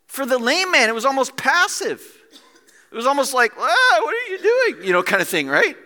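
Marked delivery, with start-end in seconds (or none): 3.5-4.8 high, loud startled cry; comic startled yelp of a question; mock alarm. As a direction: protest as if yanked upright. Acts out startled lame man.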